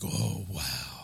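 low, soft impressed reaction; imitated awe. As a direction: imitate someone quietly impressed. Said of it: "they'll be like".

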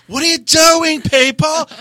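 high, loud shriek of outrage; effeminate, scandalized squawk; overdramatic drama queen. Retold or imitated. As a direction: screech at the raid like a high-strung diva. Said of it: "it was overdramatic like this".

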